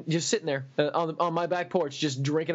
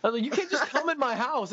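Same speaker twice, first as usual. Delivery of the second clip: higher, incredulous complaint; exasperated protest; 10 semitones higher than usual. Retold or imitated. Narrator telling Alan he can't barge in, exasperated. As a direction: push back, half laughing in disbelief.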